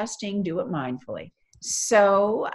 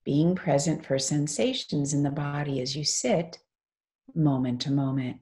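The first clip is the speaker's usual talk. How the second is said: guided meditation. Real, not acted. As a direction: lead them gently into stillness, phrase by phrase.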